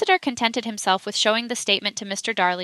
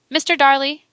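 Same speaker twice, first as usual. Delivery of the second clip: raised, louder call to the witness; higher-pitched courtroom command; 5 semitones higher, 7 dB louder than usual. A narrator's reading. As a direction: summon him to attention with authority. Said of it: "commanded the solicitor".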